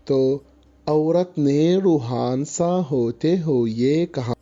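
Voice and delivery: ordinary delivery, nothing marked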